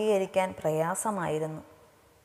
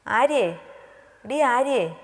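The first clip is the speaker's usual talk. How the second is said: higher, louder call to a friend; insistent, raised-pitch name call; 5 semitones higher, 4 dB louder, slower than usual. A narrator's reading. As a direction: call her out of her daydream. Friend calling a silent friend.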